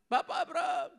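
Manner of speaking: very high pleading shout; desperate cry. Acted. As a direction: plead upward across the gulf. Rich man calls out again.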